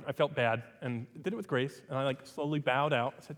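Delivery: ordinary talk, nothing marked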